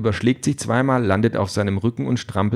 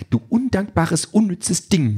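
high-pitched indignant scolding; offended, high character voice; 10 semitones higher, slower than usual. A narrator's reading.

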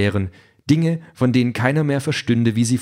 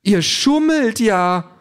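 high, slow, stunned robot voice; aghast; 9 semitones higher, 3 dB louder, much slower than usual. Acted. Robot Calliope catches the others cheating, aghast. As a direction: drag out the disbelief. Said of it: "sagt Calliope fassungslos".

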